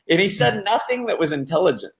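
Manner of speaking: normal talking voice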